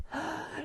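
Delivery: sharp, high intake of breath; horrified gasp. A narrator's reading. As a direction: gasp in sudden terror. Octopus creeping up behind friend.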